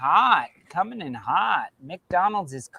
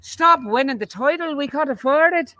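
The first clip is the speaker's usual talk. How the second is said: pleading official; 6 semitones higher, 3 dB louder than usual. Retold or imitated. Voicing Ireland's country pleading.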